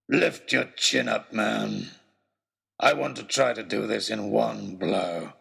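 low, slow, cold executioner's voice; a brute calmly ordering a beheading; villain coolly readying the blow; menacing villain. Acted. Villain readies machete.

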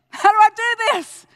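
loud, high pleading; begging heaven for an answer, loud; desperate cry to God. Retold or imitated. Remembering his cry to God at the brother's bedside, loud and pleading.

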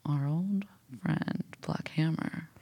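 slow unvoiced whisper. Cohost introducing an old enemy, spooky whisper. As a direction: breathe the name like a conspiracy. Cohost teasing a mysterious name.

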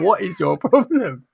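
high, loud, drawn-out mock indignation; exasperated retort. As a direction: imitate an annoyed child, slowly stressing it. Daughter's annoyed retort, acted.

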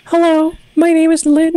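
put-on high young woman's voice. Shown as a demonstration. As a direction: sound young by pitching up. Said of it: "this is how you sound young".

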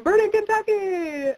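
high, drawn-out mock holler; imitated scream. Shirtless fans on four-wheelers yelling at the film set, imitated scream. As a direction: whoop it out long and loud like rowdy fans. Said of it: "on four-wheelers screaming".

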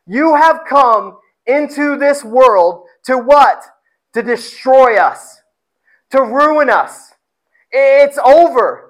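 slow, weighty demon declaration; forceful declaration. Acted. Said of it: "It forcefully makes this declaration".

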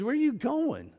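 high-pitched imitation of a puzzled friend; mock questioning. Acting out friend's surprised question.

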